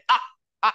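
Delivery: short আ from the throat with mouth open; open-mouthed hamza. Shown as a demonstration. Teacher shows mouth opening, abrupt open আ. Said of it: "আমরা মুখটা খুলে".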